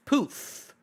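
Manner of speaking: ordinary delivery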